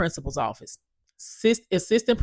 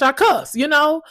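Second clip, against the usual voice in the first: ordinary talk, nothing marked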